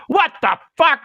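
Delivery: high-pitched imitation of a distant furious cry; mock outraged cry. Counselor finds the mess in his car, mock outraged cry. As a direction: mimic outrage carrying from the woods. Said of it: "You hear it from the woods".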